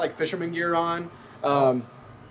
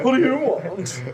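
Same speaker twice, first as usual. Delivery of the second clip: high, slow, put-upon whine; fruity townsman; 7 semitones higher, slower than usual. Acted. Townsman Jerry pestered by magician, high drawn-out camp voice. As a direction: draw it out high and campy. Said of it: "kind of a little bit fruity".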